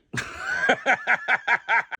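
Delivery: quick amused laugh; breathy laugh. Real, not acted. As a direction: laugh at your own story. Laughing at his own punchline.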